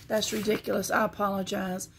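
normal talking voice

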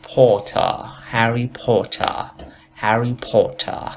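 slow "هری پوتر" as "po-tah", no r; British "Harry Potter". Shown as a demonstration. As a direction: round the o, crisp t in "پوتر".

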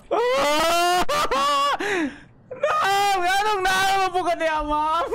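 jolted by a jumpscare, squeal it out; real startled scream turning into nervous laughter; shrieking in fright. Real, not acted. Jumpscare reaction while playing.

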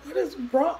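high, urgent retold question; alarmed questioning. Girlfriend reacts to the crash noise, acted alarmed questioning. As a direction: act out rising alarm.

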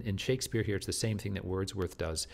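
ordinary delivery

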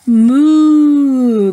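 loud, drawn-out cow moo. Acted. Teacher reading to kids, loud cow moo.

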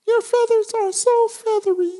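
doting bird voice. Acted. Lovebird compliments partner.

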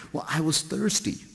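a sheepish patient excusing themselves; patient answers the scolding doctor, meekly. Retold or imitated.

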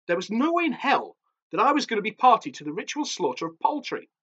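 ordinary delivery

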